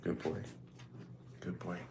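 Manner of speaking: softly praising the dog; a gentle pat in words; soft praise. Real, not acted.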